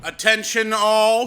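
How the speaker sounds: calling for attention. Acted. Director calling the tired cast to gather, loud slow call. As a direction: summon everyone to stop and listen.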